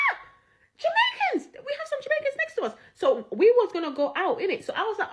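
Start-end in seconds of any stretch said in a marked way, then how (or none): 0.1-2.7 high-pitched, thrilled exclamation; gleeful outburst, voice shooting way up; excited discovery. Retold or imitated. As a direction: re-enact an excited squeal of discovery. Retelling excited discovery of neighbours.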